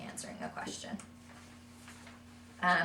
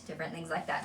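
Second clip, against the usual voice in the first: normal talking voice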